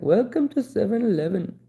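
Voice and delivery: high-pitched mock Indian accent. Streamer answering a viewer's accent request, mock Indian accent.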